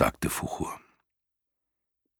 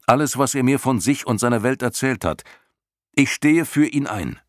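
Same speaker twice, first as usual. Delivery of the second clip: put-on higher, urgent young hero pleading; Atreju's earnest boy voice; 9 semitones higher, 4 dB louder than usual. A narrator's reading. Atreju vouches for Bastian before the snakes, pleading higher boy voice. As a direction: speak up for your friend, higher and eager.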